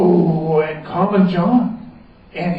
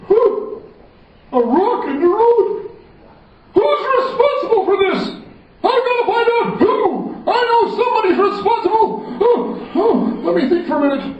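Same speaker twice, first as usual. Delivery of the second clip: much higher, excited royal bluster; fussy king; 14 semitones higher than usual. Acted. The king demands who is responsible, excited bluster.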